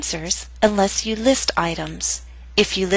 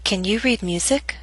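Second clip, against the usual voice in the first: "Can you read music?" rising at the end; rising intonation. Shown as a demonstration. Teacher models question two, rising intonation. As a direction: lift the pitch on "music?". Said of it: "yes-no questions use rising intonation".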